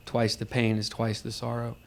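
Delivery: ordinary talk, nothing marked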